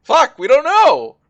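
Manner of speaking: very high, loud, slow cry of dismay; dismayed shout. Real, not acted. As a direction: howl it out in frustration.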